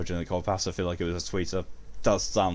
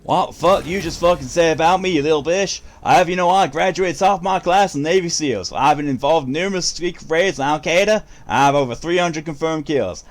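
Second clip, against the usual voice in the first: loud, higher furious tough-guy rant; Navy SEAL copypasta rant; 4 semitones higher, 5 dB louder than usual. Pretend. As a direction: bark it like an enraged Navy SEAL. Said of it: "I feel like I had a stroke saying that".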